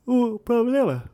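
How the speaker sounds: words stretched by a yawn. Real, not acted. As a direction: talk through a yawn. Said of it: "peraí, deixa eu bocejar".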